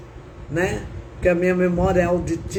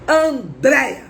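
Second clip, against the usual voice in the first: raised, emphatic summons; voice of God; 5 semitones higher, 4 dB louder, slower than usual. Retold or imitated. God calling her by name, imitated as an insistent call. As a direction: call out the name firmly, higher and punched. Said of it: "eu ouvi a voz de Deus".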